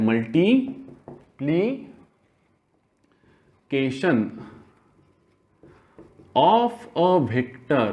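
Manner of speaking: very drawn-out words with long gaps; slow dictation. Real, not acted. Teacher writing a heading for students, slow dictation.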